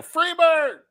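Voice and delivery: high-pitched heckler's holler; mock yell. Fan in the back row at a private show, mock yelling. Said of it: "and yell".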